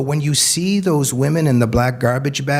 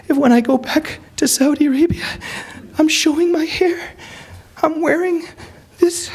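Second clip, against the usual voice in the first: much higher, timid pleading voice; meek young woman. Retold or imitated. Hijabi girl begging the speaker to delete her photo, high timid plea. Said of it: "The hijabi girl".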